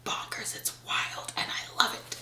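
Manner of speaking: whispered gushing. Real, not acted. Telling viewers about a wild book, whispering. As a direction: breathe it like a secret.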